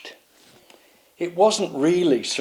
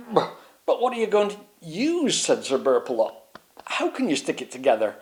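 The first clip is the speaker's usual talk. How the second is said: doubtful knight; 5 semitones higher than usual. Acted. Burpalot doubts.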